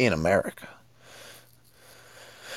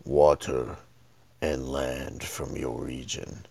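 dark lord; 9 semitones lower, 3 dB quieter than usual. Retold or imitated. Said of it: "and he's like".

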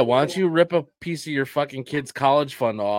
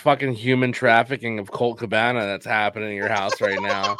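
ordinary talk, nothing marked

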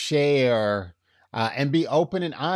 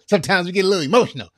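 normal talking voice

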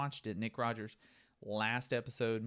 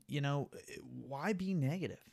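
ordinary talk

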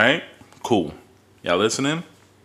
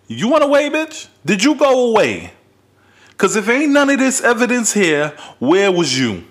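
shrill disbelieving yell, much higher; loud, high, incredulous rant; high-pitched outburst; 10 semitones higher, 4 dB louder than usual. Real, not acted. Ranting at women who don't post.

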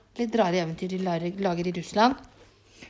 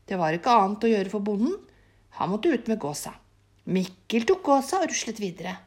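usual speaking voice

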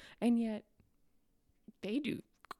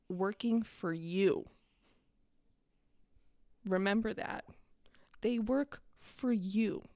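slow, weighty, every word leaned on; deliberate stressed reminder; slow emphasis; 2 semitones lower, slower than usual. Real, not acted. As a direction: drive the point home slowly.

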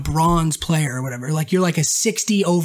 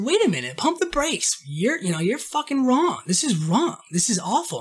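scolding; 6 semitones higher, 4 dB quieter than usual. Retold or imitated. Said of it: "I said".

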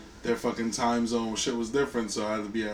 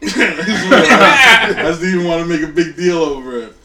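louder, slow and laughing; 5 semitones higher, 13 dB louder than usual. Real, not acted. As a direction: talk through the laughter. Said through laughter.

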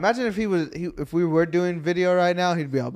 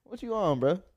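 high-pitched laughing disbelief; 6 semitones higher, 4 dB louder, slower than usual. Real, not acted. Reacts to the no-socks admission.

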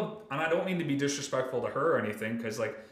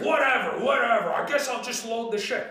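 higher and louder, grudging whatever; mock sulky surrender; 8 semitones higher, 4 dB louder than usual. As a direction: shout it like a beaten sulky grump. Worker giving in, retold.

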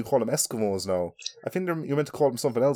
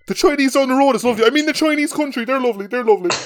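high, loud, hurried hostage babble; mock coerced praise; 11 semitones higher, 5 dB louder, faster than usual. Hostage hurriedly praises the Chinese, mock panicked. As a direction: gush praise as if threatened.

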